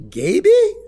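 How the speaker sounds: high, loud incredulous repeat; disbelieving echo. Retold or imitated. Father repeating the guard's nickname for his son, incredulous.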